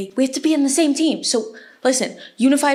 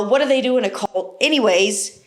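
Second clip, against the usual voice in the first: usual speaking voice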